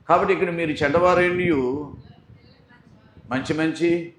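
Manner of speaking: loud preacher's cry; preaching at full force. Acted. Preacher calling out to the congregation, loud. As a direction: hammer it home to the church.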